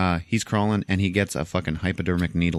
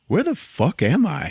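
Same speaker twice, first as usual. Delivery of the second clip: dazed confusion; 10 semitones higher, 3 dB louder than usual. Retold or imitated. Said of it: "he's just like".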